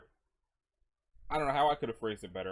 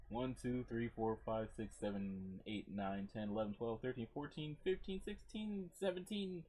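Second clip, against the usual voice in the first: counting under breath; 9 dB quieter than usual. Acted. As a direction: count to yourself, climbing at the end. Counting out peanuts in the scene.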